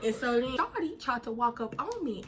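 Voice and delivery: usual speaking voice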